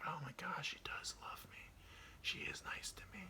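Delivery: a cat owner convincing himself; whispered self-persuasion. Pretend. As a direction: whisper it like you are talking yourself into it.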